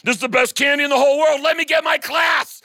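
ecstatic. Acted. Teacher ecstatic over candy.

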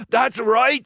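high, loud and hopeful; eager confirmation. A narrator's reading. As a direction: jump on the recognition.

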